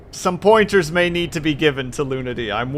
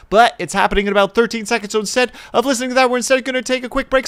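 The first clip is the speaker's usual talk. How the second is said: very fast, higher-pitched patter; rapid-fire rush; 8 semitones higher, much faster than usual. Real, not acted. Streamer sending viewers off before ads start, rapid-fire rush. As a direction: race through it before time runs out.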